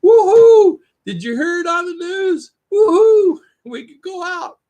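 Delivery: high, loud joyful yelling; excited whoops. Retold or imitated. A man in the street cheering the news, excited whooping. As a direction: whoop it up like lockdown just lifted. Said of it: "uh, going".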